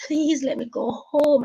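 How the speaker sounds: a touch of tearful pleading, retold. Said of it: "I was bawling and begging".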